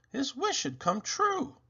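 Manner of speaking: plain reading voice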